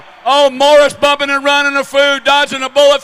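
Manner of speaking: loud, breathless race call; excited shout. Real, not acted. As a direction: ride the rush of the near crash. Co-host reacts to bumping riders.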